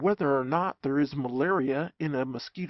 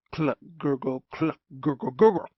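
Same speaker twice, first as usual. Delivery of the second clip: mosquito noises; 4 dB louder than usual. Acted. Mosquito sounds imitated.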